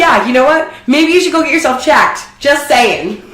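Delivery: acted-out snide advice; mock taunt. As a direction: needle them, high and loud, laugh creeping in. Acting out taunt after a hookup.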